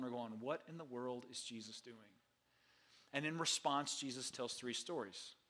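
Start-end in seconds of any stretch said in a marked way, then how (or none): 0.4-2.2 a sniffy, disapproving murmur, softer; judgmental. Retold or imitated. Critics questioning Jesus, judgmental and hushed. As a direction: mutter it like a scandalised onlooker. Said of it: "with a judgmental tone".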